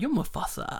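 lightly put-on hushed quote; soft, whispery film-fan echo; awed Mufasa quote. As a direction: say it softly, almost all breath. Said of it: "every time he spoke to him".

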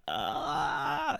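imitated high-pitched child's wail; freak-out wail. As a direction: mimic a little kid freaking out. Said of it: "He starts freaking out".

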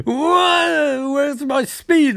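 high, drawn-out dismayed wail; mock panic. Villain finding his speed gone, mock panic. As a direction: stretch it high in hammed-up alarm.

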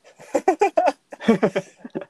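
high laugh that falls away; long high laugh. Real, not acted. Laughing at odd remark.

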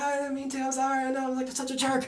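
high, small, pleading apology; mock near-breakdown. Guilty man confessing lost shoes, meek mock pleading. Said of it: "ready to lose it".

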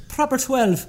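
put-on Irish accent. Friend showing others the whiskey's name, lilting high Irish accent. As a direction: say it like a cartoon Irishman. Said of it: "do it in that act".

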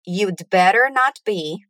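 slowly, chunk by chunk; slow drill. Shown as a demonstration. Teacher drills students, slow chunks. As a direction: model it slowly for repeating. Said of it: "Repeat again".

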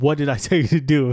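mock-scolding question, nurse voice; stern charge nurse. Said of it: "she was dominant".

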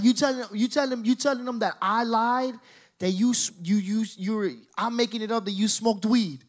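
high, stammering acted outrage; indignant accusation. Cousin confronting him by phone for calling him liar, high indignant accusation. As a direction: trip over the words in mock indignation.

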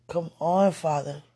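ordinary delivery

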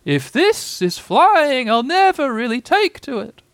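high, slow, jolted little-animal voice; very high, drawn-out, bumpy delivery; jerky thought of Piglet. A narrator's reading. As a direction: squeal it out while being bounced about. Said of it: "he thought jerkily to himself".

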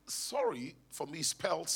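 soft, low mock-American voice; shaky attempt at American accent. Wife telling husband about sorry, imitated American accent. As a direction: try an American accent, not very well. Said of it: "I can't do accent well".

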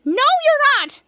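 high, loud, firm no; loud defiant refusal. Acted. Shadow refusing to let Captain Man give up, loud defiance. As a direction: cut him off with defiance.

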